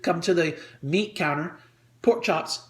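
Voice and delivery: ordinary talk, nothing marked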